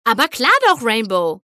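high, loud, bubbly agreement; chirping. A narrator's reading. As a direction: chirp a cheerful yes. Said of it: "zwitscherte Pinkie". (fröhlich gezwitschert)

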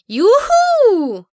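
high, loud gleeful squeal. A narrator's reading. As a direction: squeal with delight. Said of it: "quiekte Pinkie Pie".

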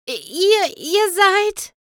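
high, whimpering stutter; frightened stammer. A narrator's reading. The pony backs away from the visitors, stammering in fear. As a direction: choke out the question half in tears. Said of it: "Das Pony wich ängstlich zurück".

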